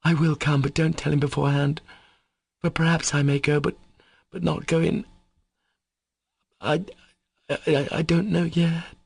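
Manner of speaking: high, halting, breaking off into stammers; voice failing. A narrator's reading. Katya half agrees to come, her voice failing. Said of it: "Her voice failed her. She gasped for breath".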